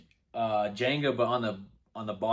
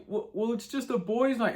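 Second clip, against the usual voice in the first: high mock boyfriend voice; 11 semitones higher than usual. Boyfriend insisting it's only the guys, high mock voice. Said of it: "He's like".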